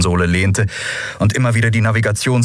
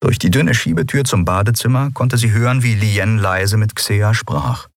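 ordinary delivery

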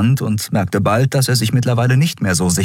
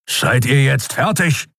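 impatient, higher-pitched snort of a question; Keru, schnaubend; 8 semitones higher than usual. A narrator's reading. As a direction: cut in, fed up with both of them. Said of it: "schnaubte Keru". (ungeduldig geschnaubt)

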